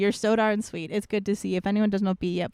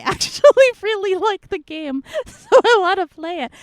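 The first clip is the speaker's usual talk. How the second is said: laughing; 10 semitones higher, 8 dB louder than usual. Real, not acted. Laughing about liking the game.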